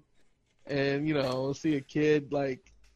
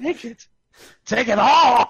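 higher, building to a loud laughing shout; mock clown shout; 7 semitones higher, 9 dB louder than usual. Friend tops the clown joke, laughing shout. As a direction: yell it like a greedy clown, laughing.

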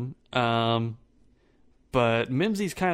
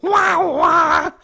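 loud, high cartoonish wail; mock wailing; 12 dB louder than usual. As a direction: belt out a big fake cry. Mock wail after the song.

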